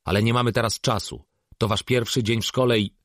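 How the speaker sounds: harried father's loud insistence; exasperated parent. Acted. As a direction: scold the kids to hurry. Exasperated father hurries his daughters.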